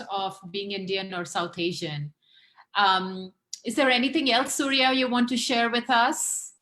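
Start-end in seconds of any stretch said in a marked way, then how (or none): none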